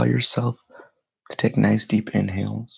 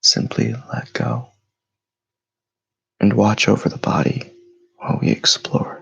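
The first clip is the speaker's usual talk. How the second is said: guided meditation; 5 dB louder than usual. Acted. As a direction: lead them through stillness, unhurried and steady. Leads a morning meditation.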